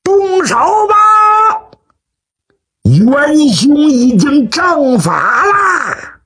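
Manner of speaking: high, long-drawn signal call. Acted. Long Tianbiao at the window to the front courtyard, calling out. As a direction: stretch each word high to carry across the yard. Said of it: "对前院就喊".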